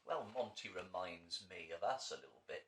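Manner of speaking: usual speaking voice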